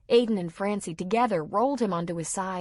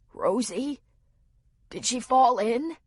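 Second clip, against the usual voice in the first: weak frightened voice; 5 semitones higher, 4 dB louder than usual. A narrator's reading. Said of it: "in a voice he could barely use".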